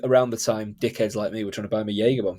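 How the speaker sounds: ordinary talk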